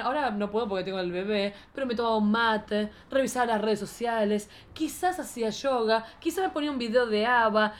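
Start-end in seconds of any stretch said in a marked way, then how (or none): none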